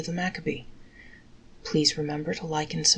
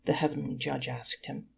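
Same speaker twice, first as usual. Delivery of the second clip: ordinary delivery, nothing marked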